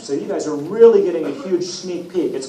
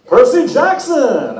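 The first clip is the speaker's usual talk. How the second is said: slow, higher, louder beaming greeting; beaming Apollo; 7 semitones higher, 4 dB louder, slower than usual. Acted. God Apollo beaming at Percy, slow and loud. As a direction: grin through each word of the name. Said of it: "Apollo beamed at me".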